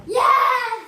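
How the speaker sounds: loud joyful cheer; excited yes. Real, not acted. Delighted at present.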